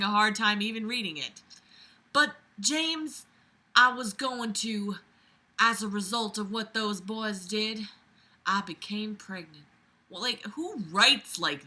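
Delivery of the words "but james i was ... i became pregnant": put-on halting Southern belle accent, with pauses; [name], Southern belle. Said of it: "my Southern Belle accent".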